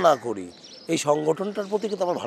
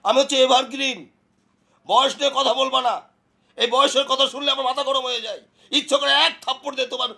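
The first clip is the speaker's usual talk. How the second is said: high-pitched, touchy indignation; heated acted threat; offended outburst; 7 semitones higher than usual. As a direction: bristle at being called old, pitch raised. Offended about age, threatens a slap.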